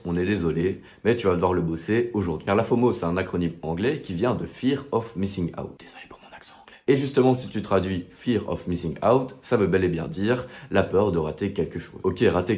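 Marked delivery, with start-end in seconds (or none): 5.8-6.8 very soft, whispered apology; whispered aside. Real, not acted. Apologising to viewers for an English accent, whispered.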